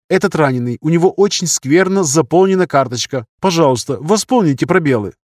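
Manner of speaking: put-on eager young surgeon. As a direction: pitch it up like an earnest junior colleague. (молодой хирург, высоким голосом, вежливо)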